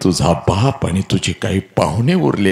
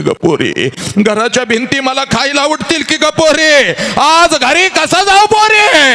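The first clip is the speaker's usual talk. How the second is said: 1 wailing mother; 3 dB louder than usual. Acted. Mother lamenting as the daughter leaves, wailing high. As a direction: cry it out like a mother losing her daughter.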